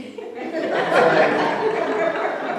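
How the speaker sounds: high rising burst of laughter; real laugh. Real, not acted. Laughing at the ex-inmate's apology, high rising laughter. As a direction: laugh out loud at the absurdity. Laughs after story punchline.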